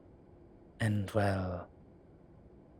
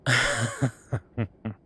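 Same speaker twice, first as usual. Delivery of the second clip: hearty amused laugh; delighted laugh; loud laugh; 2 semitones higher, 6 dB louder than usual. Acted. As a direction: burst out laughing at your own joke. After bawdy story.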